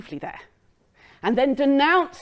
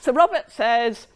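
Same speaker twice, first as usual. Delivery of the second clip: ordinary delivery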